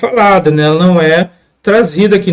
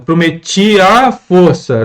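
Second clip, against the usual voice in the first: slow, the a of prometi a força blended; slowed to show the merge; slower than usual. Shown as a demonstration. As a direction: stretch prometi a força so the two a's fuse. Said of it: "duas vogais novamente iguais".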